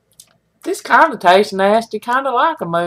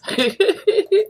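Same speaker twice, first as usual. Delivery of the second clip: a short high giggle; little high laugh after the joke; 11 semitones higher than usual. Real, not acted. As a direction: giggle at your own joke.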